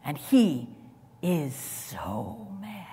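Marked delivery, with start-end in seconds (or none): 1.2-2.9 slow, whispered; hushed intensity. Acted. Preacher describing Jesus' anger, whispered slowly. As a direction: drop to a whisper to make it land.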